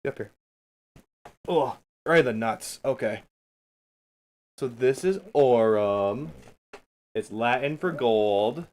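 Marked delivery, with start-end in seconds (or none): none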